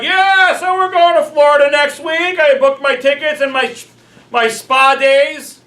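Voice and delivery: loud, high-pitched show-off announcement; oblivious excited bragging. Acted. Traveller to a group who cannot afford it, bragging loudly. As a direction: gush about the trip, mock-thrilled.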